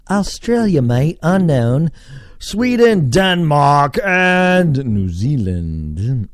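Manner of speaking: high roll call of countries; high, showy announcing; hammed-up announcer. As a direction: call out each country like a show host. Announces list of listening countries.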